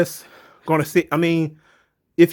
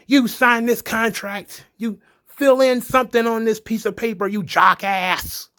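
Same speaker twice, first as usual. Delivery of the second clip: snapping villain; 7 semitones higher than usual. Retold or imitated. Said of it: "Braille snaps".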